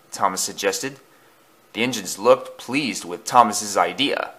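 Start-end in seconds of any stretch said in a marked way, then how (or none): none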